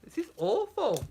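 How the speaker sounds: theatrical mild lament; mock tragic lament. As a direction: ham up the doom. Mock tragedy.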